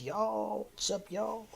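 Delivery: a touch of mock-Southern twang; playful Southern hello; Southern greeting. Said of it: "a Southerner said".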